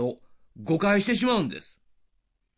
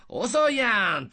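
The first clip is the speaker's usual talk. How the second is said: drawn-out, higher playful jab; friendly banter; 5 semitones higher, slower than usual. Acted. Joking with a friend who is late, playful complaint. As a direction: tease a friend with stretched vowels. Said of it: "楽しい友人関係のノリで".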